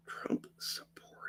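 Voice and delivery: breathy whisper under the breath. Real, not acted. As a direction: whisper it to yourself. Rereading troll comment softly.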